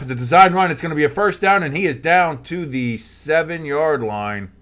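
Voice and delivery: building live commentator call, rising into the run; play-by-play call. Acted. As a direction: call it like a broadcaster as the play breaks.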